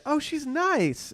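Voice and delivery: high, slow, drawn-out sugary reassurance; mock matchmaker praise. To a single friend asking about a setup, high and slow sugary. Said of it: "and then they say".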